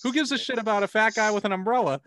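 rising, exasperated rant, pitch climbing high; mock rant at Nolan. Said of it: "And I'm like".

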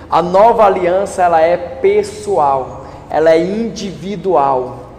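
slow, weighty, word by word; slow emphasis. Real, not acted. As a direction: lay each word down slowly. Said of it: "slow emphatic sermon point".

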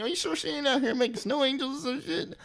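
high sarcastic cop voice; mocking cop. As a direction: tease the son like a smug cop. Cop mocking grandma's absence.

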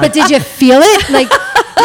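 high, loud, through laughter; laughing. Real, not acted. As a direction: laugh the punchline out. Laughing joke.

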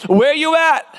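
high, hollered call to the crowd; rallying pulpit yell, pitch way up; call-and-response shout. Real, not acted. Calling out to the congregation.

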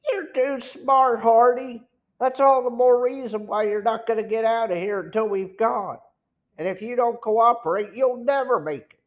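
high-pitched, forceful villain; threatening gang boss. A narrator's reading. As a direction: bear down on the captive as the boss.

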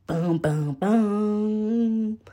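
soft mouthed boom effect; made-up dramatic sound. Pretend. Revealing the murder, soft boom effect. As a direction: thud out three booms quietly. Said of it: "Do y'all like my made-up sounds".

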